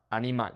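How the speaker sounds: Animal run together, ah-nee-MAHL; Spanish "Animal" with open a sounds; Spanish pronunciation. Shown as a demonstration. Teacher joins the syllables back up, Spanish Animal. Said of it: "focusing on the vowel sounds".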